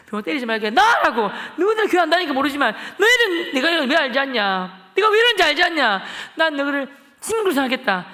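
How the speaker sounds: loud, high, hurt rant; angry tirade. Retold or imitated. Betrayed boy shouting at classmates who never told him, furious. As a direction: tear into friends who never invited him.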